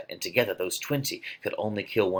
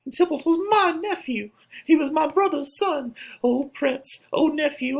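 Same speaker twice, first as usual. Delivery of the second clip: very high, loud, lamenting woman's voice; keen over the dead nephew; wailing Lady Capulet; 3 dB louder than usual. Acted. Lady Capulet cries to the prince over Tybalt, high wail.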